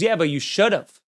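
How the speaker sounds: ordinary talk, nothing marked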